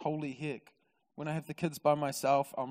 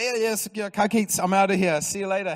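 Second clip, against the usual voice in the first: loud, higher, flustered brush-off; comic eagerness to get out; hurried exit; 6 semitones higher, 9 dB louder than usual. Retold or imitated. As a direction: gabble a goodbye while making a getaway. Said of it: "He's like".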